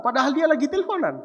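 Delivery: fast, laughing through the words. Real, not acted. Preacher mocking his father's trick, laughing fast. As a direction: chuckle it out quickly.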